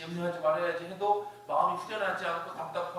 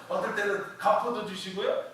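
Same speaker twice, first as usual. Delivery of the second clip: usual speaking voice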